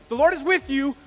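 loud, high call for everyone to join in; booming lead for the congregation to repeat; rallying proclamation. Shown as a demonstration. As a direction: proclaim it big, stretching the last words. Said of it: "say it with me".

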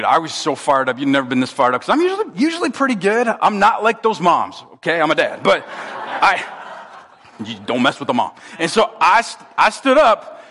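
ordinary talk, nothing marked

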